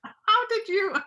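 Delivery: woman amazed at the ex-convict's knowledge, gasping question; astonished. Acted. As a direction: caught off guard by what he knows. Astonished he knows date.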